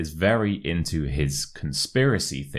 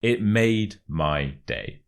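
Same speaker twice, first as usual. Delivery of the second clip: word-by-word "It made my day"; measured teacher's demonstration, each word separate; slow model phrase; slower than usual. Shown as a demonstration. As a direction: present the phrase slowly for learners. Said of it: "the second expression is".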